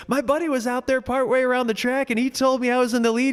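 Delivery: high, earnest explaining; racer explaining. Retold or imitated. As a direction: tell it high and eager. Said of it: "And he goes".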